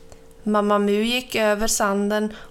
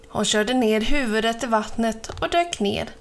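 ordinary delivery

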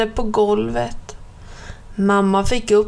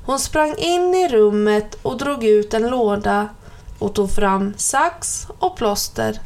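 ordinary delivery

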